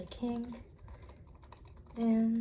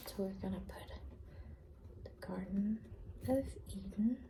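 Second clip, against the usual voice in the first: library whisper; 7 dB quieter than usual. Real, not acted.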